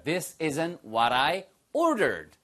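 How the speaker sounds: very slow, clear model sentence; slow teacher demo. Shown as a demonstration. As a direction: say it slowly so they can copy. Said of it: "your turn".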